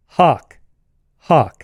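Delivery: "Hawk" with the same open ah as hock; merged vowel. Shown as a demonstration. Interviewee reading out test words, both "Hawk" with one vowel. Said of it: "I want you to say those".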